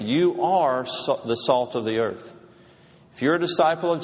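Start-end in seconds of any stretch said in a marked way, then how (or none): 0.0-2.3 "You" stressed and drawn out slowly; slow, pointed declaration; emphatic you are. Retold or imitated. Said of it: "he's really pointing a finger".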